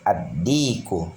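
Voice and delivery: slow Ad-diku, no l, straight into a doubled d; lam left silent. Shown as a demonstration. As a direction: hold the d of Ad-diku in place of the lam. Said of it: "alif lamnya tidak dibaca".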